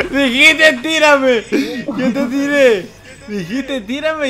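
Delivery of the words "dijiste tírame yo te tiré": loud, high gleeful taunt; loud laughing taunt. Real, not acted. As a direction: crow it loudly. Mocking friend after laugh.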